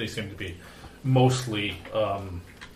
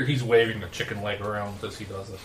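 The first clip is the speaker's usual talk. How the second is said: normal talking voice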